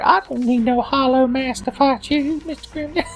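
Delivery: very high, silly cartoon voice; hammed-up high squeaky hero; Ichigo. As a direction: put on an absurdly high character voice. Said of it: "That's my Ichigo impression".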